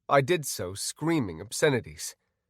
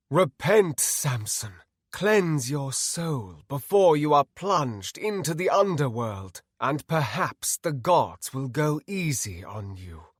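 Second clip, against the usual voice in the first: grand, commanding sermon; solemn, imperious condemnation; righteous villain paladin; 3 semitones higher than usual. Acted. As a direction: demand repentance like a zealot. Villain paladin Artemis orders repentance.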